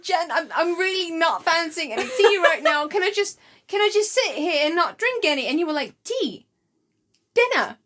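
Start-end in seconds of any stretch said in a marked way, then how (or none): 0.0-5.5 pitched up, pleading not to drink tea; mock desperate plea, voice lifted high; high pleading refusal. Friend retelling turning down tea, high pleading voice. 6.0-7.8 mock baffled question. Mimicking friend calling tea dinner.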